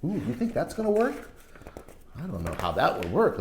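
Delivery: ordinary delivery, nothing marked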